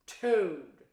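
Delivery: loud, stern call; severe. Acted. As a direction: call him to account. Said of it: "he said severely".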